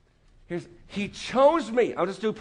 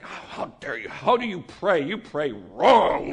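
mock indignation; 4 semitones lower, slower than usual. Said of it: "It's like".

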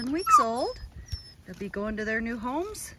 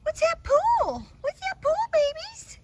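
puppy's voice; 4 dB louder, slower than usual. Pretend. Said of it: "This time is like".

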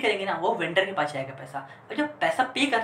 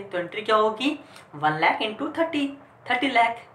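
ordinary delivery

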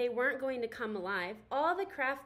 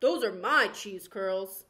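slow, louder indignant yell; 4 semitones lower, 10 dB louder than usual. Acted. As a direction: bark it at the thief. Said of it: "yelled at her".

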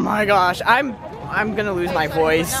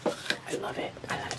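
soft whispered delight; 17 dB quieter than usual. Real, not acted. Whispering to the kitty, soft and delighted. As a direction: breathe it out so the cat stays calm.

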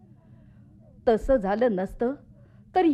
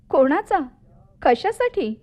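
startled questions, higher and louder; alarmed questioning; 6 semitones higher, 6 dB louder than usual. Acted. Wife questioning her husband's talk of revenge, startled. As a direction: pitch jumps up in alarmed disbelief.

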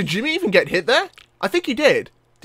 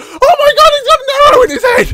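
very high, loud, rapid screaming; frantic squealing outburst; horrified shriek; 10 dB louder, faster than usual. Real, not acted. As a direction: shriek it out in shocked panic. Sees arrow in head.

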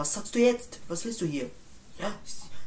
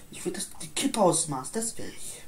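usual speaking voice

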